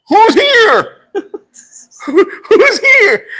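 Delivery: panicked high laughing cry in an empty room; frightened laughing. Retold or imitated. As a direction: call out scared into the empty room. Startled by a voice at night.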